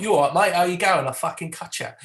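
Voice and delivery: caricatured Cockney geezer; Cockney accent. Shown as a demonstration. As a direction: put on a matey London Cockney, dropping away at the end. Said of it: "And then London".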